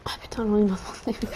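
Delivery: a fed-up whisper under his breath; whispered grumble. Real, not acted. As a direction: whisper your annoyance to yourself. Râle à mi-voix. (râle chuchoté)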